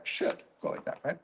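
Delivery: the same words dropped to a soft whisper. Shown as a demonstration. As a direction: breathe it out, almost to yourself. Repeats the phrase, now whispered.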